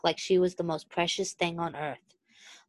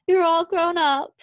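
high, loud tearful laugh; half laughing half crying; 11 semitones higher, 6 dB louder than usual. A narrator's reading. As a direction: laugh through tears, overwhelmed. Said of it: "she half laughed, half cried".

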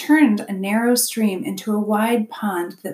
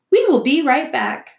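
higher, louder cheerful promise; raised, keen robot voice; eager robot; 7 semitones higher, 6 dB louder than usual. A narrator's reading. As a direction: announce it brightly as an eager robot. Robot eager to fetch trees.

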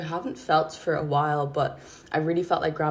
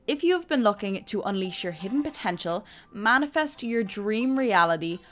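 upbeat promo voice over music, pitched up; podcast intro read; 3 semitones higher than usual. Acted. Host pitching the podcast to ambitious women, upbeat promo read. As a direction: read it like a polished show trailer.

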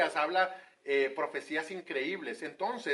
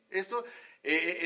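ordinary talk, nothing marked

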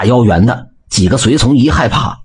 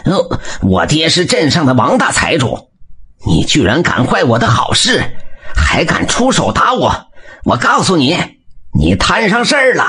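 rising higher and higher, bullying shout; fierce threat; 6 semitones higher than usual. A narrator's reading. The rich man's son threatens the blacksmith fiercely. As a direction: threaten like a spoiled rich brat. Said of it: "凶巴巴地喊着".

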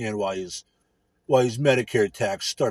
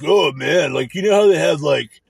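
mock dumb coworker; 4 semitones higher, slower than usual. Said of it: "it's like".

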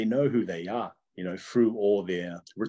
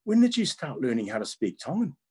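high-pitched, puzzled question; mock-amazed, voice pitched up; imitated surprised students; 6 semitones higher than usual. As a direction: imitate an old classmate's astonishment. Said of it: "they're looking at me like".

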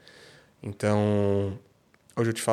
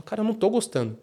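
higher-pitched, mildly emotional; retold emotional admission; 8 semitones higher than usual. Father retells telling his son he dislikes punishing, mildly emotional. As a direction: admit it with a touch of upset. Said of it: "eu tava emocionado na hora".

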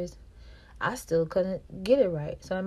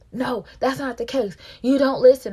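raised, higher voice scolding her; mock angry boyfriend; 6 semitones higher, 10 dB louder than usual. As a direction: imitate him snapping about the phone call. His angry retort retold.

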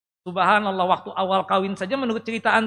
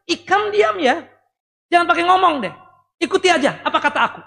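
harsh husband; 6 semitones higher, 4 dB louder than usual. Retold or imitated. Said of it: "Ucapan yang tidak baik seorang suami".

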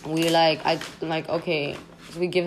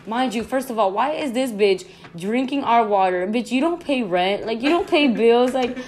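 higher and louder, sassy mock telling-off; mock scolding; 5 semitones higher, 5 dB louder than usual. Scolding the possum that drank their water, sassy mock rant. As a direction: tell the possum off, half laughing.

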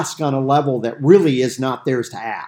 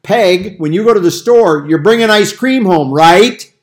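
louder, leading question expecting yes; presumptuous question; 4 semitones higher, 10 dB louder than usual. Retold or imitated. Husband telling Peg to bring ice cream, presumptuous. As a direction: nudge her so she can only say yes. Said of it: "It'd be like".

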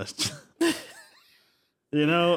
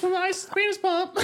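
high cartoon quote. Quoting a parody PSA to a friend in a high cartoon voice. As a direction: mimic a silly dubbed PSA line.

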